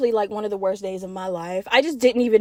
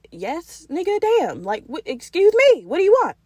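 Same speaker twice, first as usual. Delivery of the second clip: high-pitched, irritated retort; annoyed comeback; 6 semitones higher than usual. Retold or imitated. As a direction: act out being fed up with a pest. Snaps at man following her.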